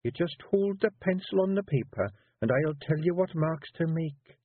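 high Scottish Feegle woman coaxing; wife coaxing her husband into writing. A narrator's reading. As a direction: push your husband gently, high and Scots. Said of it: "said Jeanie".

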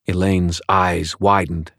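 plain reading voice

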